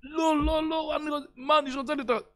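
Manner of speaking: high pleading cry, a voice apart; frantic refusal. Retold or imitated. Menish refusing his mother's offer, high and frantic. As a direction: refuse in panic, pitched high. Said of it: "הוא התחיל צעקות".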